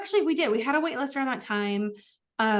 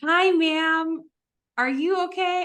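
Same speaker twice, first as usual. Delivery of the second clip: higher, gently concerned greeting; caring check-in; 6 semitones higher, 4 dB louder, slower than usual. Retold or imitated. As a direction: reach out kindly to a scared stranger. Greeting the scared seatmate.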